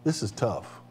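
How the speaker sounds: hushed, strained under the weight; a quiet groan of a line; pained aside. Retold or imitated. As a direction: let it slip out low and heavy. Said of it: "His humanity is going".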